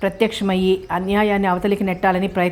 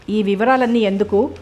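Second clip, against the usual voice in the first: usual speaking voice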